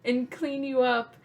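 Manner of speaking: high, mock-soothing big sister voice; calm him down sweetly while faking it; imitating a child reassuring her crying brother. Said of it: "And I'm just like".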